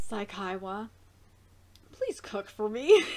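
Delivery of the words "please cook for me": playful plea. Real, not acted. Begging character playfully.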